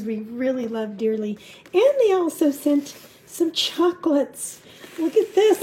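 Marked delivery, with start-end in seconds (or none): 1.7-5.6 higher, louder and gushing; excited. Real, not acted. Shows viewers chocolates from friends, excited gushing. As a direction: show off the chocolates with glee.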